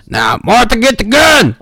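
very loud. Acted. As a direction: holler for your wife at full volume. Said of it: "you were getting so loud".

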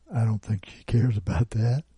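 ordinary delivery, nothing marked

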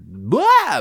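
sudden high, loud "blah" yelp; silly "blah" noise. Shown as a demonstration. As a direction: yelp "blah" in the middle of the sentence. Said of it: "so I can do that noise".

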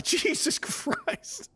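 high-pitched, drawn-out exasperation; mock outburst. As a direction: stretch it out in a high voice. Imagined family yelling, reacting.

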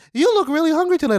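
high, loud, sing-song auntie voice; kind auntie. Retold or imitated. Poke-shop auntie greeting a hungry customer, high and bright. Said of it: "she's like".

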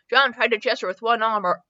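plain reading voice

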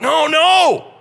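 high, loud protest; people protesting. Retold or imitated. The crowd refusing Joshua's doubt, loud protest. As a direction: cry out in protest. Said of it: "the people are like".

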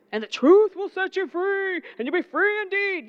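mock-preachy quote; preacher quoting. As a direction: proclaim it like a pulpit favorite. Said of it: "we love to talk about the truth".

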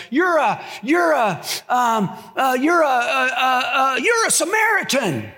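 high shouted stutter building up; stammering taunt. Retold or imitated. Accusers stammering at Jesus, high shouted stutter. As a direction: fumble for the insult, then blurt it.

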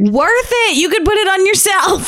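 high giddy excited voice. Real, not acted. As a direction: bubble over with glee. Giddy excitement about collar.

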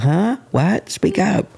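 high-pitched, puzzled imitation; mock hard-of-hearing. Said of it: "I'm always like".

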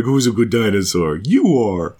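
lightly cooing at a pet dog; a hint of baby talk to a doggy. [name] petting the shrunken dinosaur, lightly cooing. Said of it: "And it's just like".

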